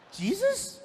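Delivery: high questioning mock kid voice; puzzled boy. Son guessing who gave him life, puzzled and high. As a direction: guess it with a steep upward lilt.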